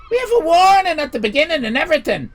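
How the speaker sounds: loud, high, rushed protest; indignant outburst. Retold or imitated. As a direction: blurt it out in mock outrage. Acted indignant protest at the compliment.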